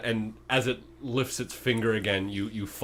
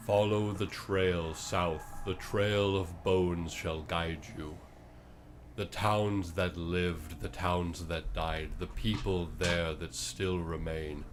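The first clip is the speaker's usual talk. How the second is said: slow solemn prophecy; 2 semitones lower, slower than usual. Acted. Creature slowly tells the party where to go. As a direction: intone each line like a seer.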